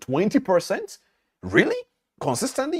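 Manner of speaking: high, loud incredulous questions; astonished disbelief. Retold or imitated. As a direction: imitate someone who can't believe the figure. Millionaire astonished at the return.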